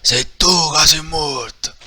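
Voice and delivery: drawn-out loud shouted comeback; slow loud retort. Acted. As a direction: throw the threat back slowly. Hero retort.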